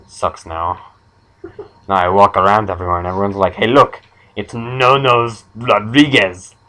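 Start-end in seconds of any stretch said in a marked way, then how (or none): 3.5-6.6 slow, drawn-out mock jeer; mock taunting. Passers-by jeering at No Nose Rodriguez, mock taunting. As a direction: imitate people calling out a cruel nickname. Crowd taunting him in the story.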